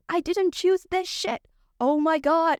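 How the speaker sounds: high, slow, emphatic denial; indignant protest. Real, not acted. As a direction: drag out each word in exasperation. Answers own question about her illness.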